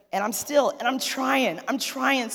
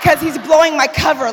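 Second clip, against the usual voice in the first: loud comic outrage; louder exasperated punchline; mock outrage; 6 semitones higher, 11 dB louder than usual. Real, not acted. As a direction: blow up in mock fury. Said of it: "I'm about to go off".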